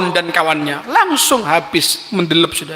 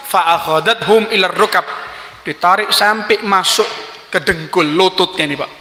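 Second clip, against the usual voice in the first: usual speaking voice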